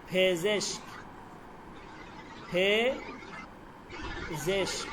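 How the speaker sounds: ফেজেস drawn out very slowly with a rise; teacher stretching ফেজেস for dictation; stretched pronunciation. Shown as a demonstration. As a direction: linger on each sound of ফেজেস. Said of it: "উচ্চারণটা দেখেন".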